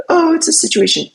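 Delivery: very high-pitched mocking fan voice; high mock protest, not truly yelled; mock complaining fans. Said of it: "Bears fans are gonna yell at me like".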